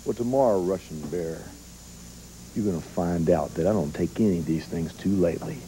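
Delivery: low, soft threatening promo; quiet menace. Acted.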